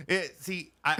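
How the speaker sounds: usual speaking voice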